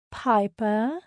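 lilting, syrupy sweet; sugary sweet. A narrator's reading. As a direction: coo the name with mock innocence. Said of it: "Her voice was sugary sweet".

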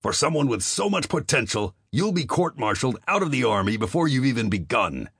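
a commander blowing up at a private; near shout. Acted. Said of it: "all but shouted".

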